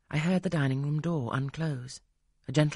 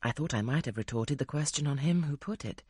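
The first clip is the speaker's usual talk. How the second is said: plain reading voice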